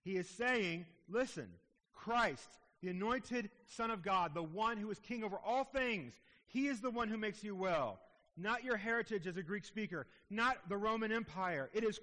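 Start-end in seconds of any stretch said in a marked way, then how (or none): none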